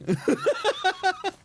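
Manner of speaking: high rapid giggle; laugh. Real, not acted. Chatting about a dragon left at the house, high giggle.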